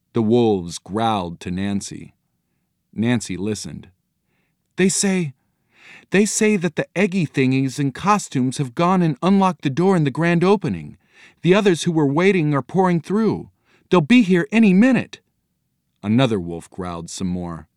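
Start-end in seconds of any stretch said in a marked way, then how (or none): 4.8-15.2 high-pitched girl breathlessly relaying bad news; young girl, urgent. A narrator's reading. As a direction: build to a louder warning, pitched high. Voices Nancy translating urgent news.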